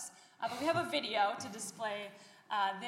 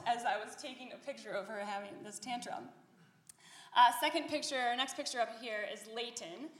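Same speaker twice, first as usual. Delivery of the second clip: ordinary delivery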